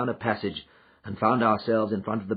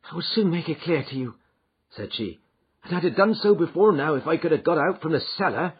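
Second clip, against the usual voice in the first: higher, louder servant woman; housekeeper, eager to tell; 10 semitones higher, 6 dB louder than usual. A narrator's reading. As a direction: press her loyalty earnestly. Housekeeper voiced.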